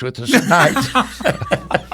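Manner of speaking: slow, laughing through the words; chuckling as he tells it. Real, not acted. Telling the host about the cat, laughing.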